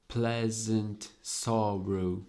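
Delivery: "Pleasant sorrow" slowed right down; slow syllable stress. Shown as a demonstration. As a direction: space out both syllables of each word. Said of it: "made by two syllables each".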